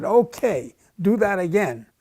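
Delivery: firm, loud insistence on a retry; loud demand. Retold or imitated. Young cadet demanding the examiner retest him, loud and firm.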